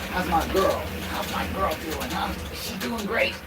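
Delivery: high, sing-song pet voice; doggy talk. Real, not acted. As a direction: coo and cheer the dog along. Cheers dog Big Mac on.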